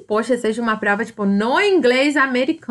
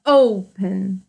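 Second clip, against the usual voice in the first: "open" drawn out slowly, the O strong; stress on O; 3 semitones lower than usual. Shown as a demonstration. Teacher showing which syllable is strongest, slow stressed O in "open". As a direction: stretch the first syllable of "open", O-pen. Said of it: "O O é o mais forte".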